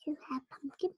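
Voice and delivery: shy little whisper, all breath. Real, not acted. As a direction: whisper the answer shyly. Shy child answers mother.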